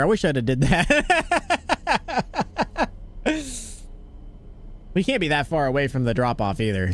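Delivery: ordinary talk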